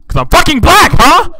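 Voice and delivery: high, very loud accusing shout; screamed accusation; mock outraged yell. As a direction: explode in pretend offense. Mock offense, escalates into yelling.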